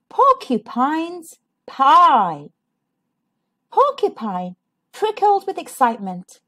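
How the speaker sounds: usual speaking voice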